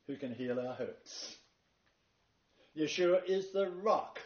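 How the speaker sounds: rising, forceful sermon cadence; preaching. Acted. Preacher proclaiming Yeshua's titles to the congregation, fervently. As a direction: build it up like a closing sermon.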